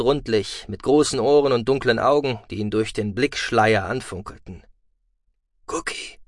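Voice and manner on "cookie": very soft, unvoiced query; hushed, tentative call of a name; faint question. A narrator's reading. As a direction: ask it weakly, barely voiced. Dazed hero asks for his friend.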